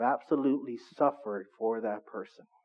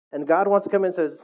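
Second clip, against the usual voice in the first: usual speaking voice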